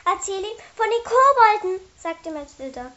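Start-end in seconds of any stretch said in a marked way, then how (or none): 0.1-1.8 high, loud indignant demand; angry urging. Acted. Mathilda urges Jollibuk to tell about the goblins, angrily. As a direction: push him to tell, high and heated. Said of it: "Vor Ärger hatte sich rote Flecken".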